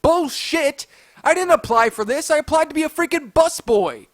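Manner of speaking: loud, high-pitched protest; retold angry objection; indignant arguing. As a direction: argue back in outraged disbelief. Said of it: "trying to argue and reason".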